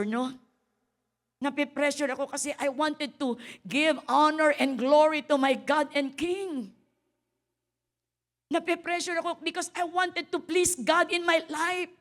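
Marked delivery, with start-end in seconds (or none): 1.4-11.9 louder, higher preaching. Acted. Pastor telling the congregation about honor to God, louder. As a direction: build it up with conviction.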